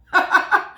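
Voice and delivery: short breathy laugh; quick unvoiced chuckle; airy laugh. Real, not acted. Laughing at own joke.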